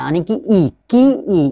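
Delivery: ई in की drawn out with force; की with a heavy, pushed ई; stressed long ई. Shown as a demonstration. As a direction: put weight on the ई in की. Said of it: "इस पे थोड़ा जोर sound लगता है".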